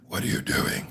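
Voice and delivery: hushed, creepy monster voice; put-on man voice. Prank caller phoning own mother, hushed monster voice. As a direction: play the scary prank caller, kept soft. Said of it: "I call Mary and I go".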